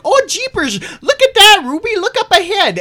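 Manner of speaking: very high, loud warning cry; acted alarm. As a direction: shriek a frantic warning to the driver. She's like, spotting deer ahead.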